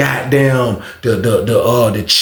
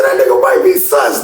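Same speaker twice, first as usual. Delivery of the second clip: much higher, loud; mock yell; mock shout; 3 dB louder than usual. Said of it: "he said".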